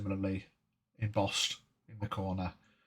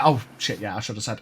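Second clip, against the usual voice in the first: usual speaking voice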